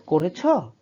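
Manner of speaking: demonstrated question tune on "করেছো"; rising question intonation. Shown as a demonstration. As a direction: raise the voice on "করেছো" to ask it. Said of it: "by just raising an, uh, raising intonation".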